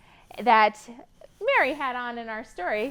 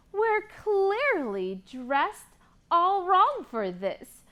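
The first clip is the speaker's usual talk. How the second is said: rhyming picture book read with lively lilt; reading to children; slower than usual. Acted. Teacher reading a rhyming book to kids, lively. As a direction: read the rhymes to a class of kids.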